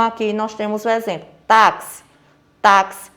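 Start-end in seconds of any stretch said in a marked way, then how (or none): 1.5-2.0 táxi with a loud, punched first syllable TÁ; stress on TÁ. Shown as a demonstration. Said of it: "a sílaba tônica é tá". 2.6-3.1 Táxi punched loud on its first syllable; stress on TÁ. Shown as a demonstration. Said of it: "a sílaba tônica é tá".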